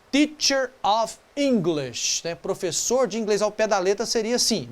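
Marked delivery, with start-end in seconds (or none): 0.1-2.2 teacher of English said slowly, word by word; slow literal form. Shown as a demonstration. Teacher slowly sounding out the literal translation. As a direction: stretch each word apart. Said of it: "se eu fosse falar".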